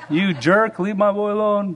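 a protective mom told half in jest; mock angry mom. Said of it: "She may have run in, tackled the coach".

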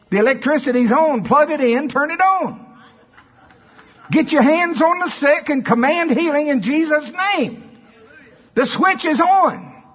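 high, loud, exclaimed commands; rally the congregation with ringing, high shouts; full-force preaching. Real, not acted.

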